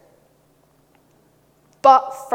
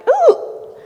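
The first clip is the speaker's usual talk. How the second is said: sharp, high-pitched "ooh" of recognition; little squeal of delighted surprise; high startled ooh; 10 dB louder than usual. Retold or imitated. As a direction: let out a loud, very high ooh. Reacting to a familiar accent.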